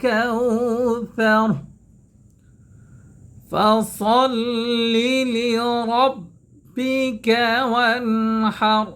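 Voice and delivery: slow, chanted tajwid recitation; Quran recitation. Acted. Teacher recites the full surah for students to follow, chanted. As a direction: recite it slowly, melodic and held.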